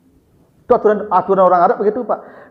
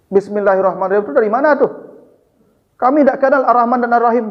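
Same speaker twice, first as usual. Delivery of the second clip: higher, quicker voice for the objecting envoy; Suhel objecting; 6 semitones higher, faster than usual. Retold or imitated. Suhel the Quraysh envoy protesting to the Prophet, higher and hurried. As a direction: press the objection fast and a bit higher.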